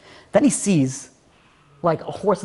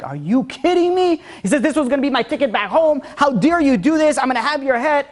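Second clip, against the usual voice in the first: outraged prince; 7 semitones higher than usual. Retold or imitated. Prince raging at the prisoner over the shattered diamond, much higher. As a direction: rage at the prisoner like a spoiled royal.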